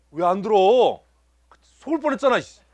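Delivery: high, loud playful scolding; mock indignation. Pastor teasing members who hid their hands, mock indignant. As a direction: pretend to be outraged, pitch jumping up.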